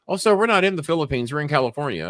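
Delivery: a touch of a cheerful call-center man; imitating polite supervisor. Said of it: "He said".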